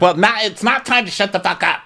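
high-pitched defiant comeback; hammed-up squabbling; mock indignant retort. Acted quarrel retort.

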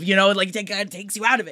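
ordinary delivery

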